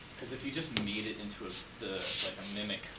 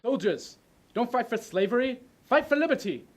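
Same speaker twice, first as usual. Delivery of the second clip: high, loud, impassioned shouting; ranting dictator speech; 17 dB louder than usual. Acted. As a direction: rouse the soldiers with full force. Rehearses climax of speech.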